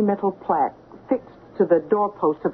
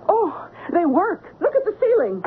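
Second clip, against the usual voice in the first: triumphant reveal; 11 semitones higher than usual. Acted. Housekeeper springs her trap with sudden high glee. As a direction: pitch jumps high, pleased and defiant. Maggie reveals loaded pistols, fires.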